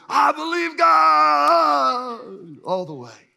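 long, high, drawn-out holler; slow, exultant full-voiced cry; all-out believer's shout. Shown as a demonstration. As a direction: yell it like a fearless leap. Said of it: "and one is".

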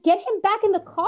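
loud high command; angry demand. Retold or imitated. Girlfriend ordering police to release him, loud angry demand. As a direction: order them, furious.

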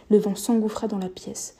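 ordinary delivery, nothing marked

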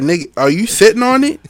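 high, loud incredulous demand; retold outburst. Podcaster re-enacts confronting someone over the remote, loud incredulous shout. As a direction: re-enact it loudly, pitch way up.

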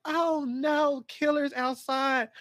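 hammed-up clueless horror girl; mock dumb victim. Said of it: "dumb mode where y'all are like".

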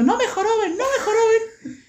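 high, drawn-out mocking whine; sing-song taunt. Kid with a jacket on his back playing around, high mocking whine. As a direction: chant it way up high, dragging it out.

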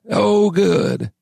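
put-on high, harsh old-timer voice; old man's mutter. A narrator's reading. Old man seeing the miners stir, high gravelly mutter. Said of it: "muttered the man".

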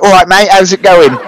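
put-on London accent. Manager greeting you in his British way, London accent. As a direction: imitate a chummy Londoner's greeting. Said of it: "he's got a real, like, London accent".

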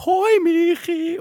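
very high, loud, stretched-out greeting; hammed-up imitation of an eager hail; gushing call. Station woman meeting her again, gushing call.

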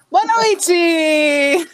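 bright, loud, high hello; loud cheerful greeting. Pretend. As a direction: call out a big hello. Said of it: "Cadê?". (saudação alta e animada)

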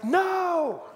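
high, small drawn-out voice; high little-kid voice; toddler daughter. Retold or imitated. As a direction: imitate a little girl, softly defiant.

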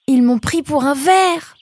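s'exclamer très haut et fort; éclater en comprenant; révélation. A narrator's reading. Nemrod realizes. (Nemrod comprend soudain, exclamation aiguë)

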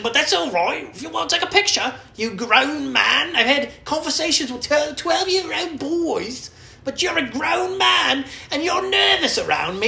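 high, loud, drawn-out caricature rant; mock scolding musician. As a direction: berate the fan in a put-on voice. Acts musician scolding the fan.

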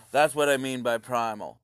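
usual speaking voice

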